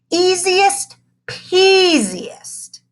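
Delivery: very high, very slow gloating sing-song; very loud. Acted. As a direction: crow it out to the whole class. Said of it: "in a very loud voice".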